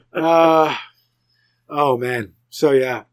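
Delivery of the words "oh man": ordinary talk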